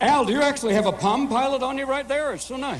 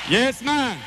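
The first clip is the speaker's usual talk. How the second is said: put-on cheery Jamaican-accented agreement. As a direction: throw out "Yes, man" in a Jamaican accent.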